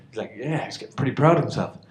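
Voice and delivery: ordinary talk, nothing marked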